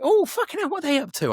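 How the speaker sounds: very high-pitched exclaimed wondering; sudden curiosity. Acted. Suddenly thinking about friends he forgot, curious. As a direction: pitch leaps up as if just remembering them.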